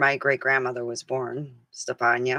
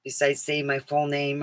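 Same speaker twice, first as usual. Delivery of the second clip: usual speaking voice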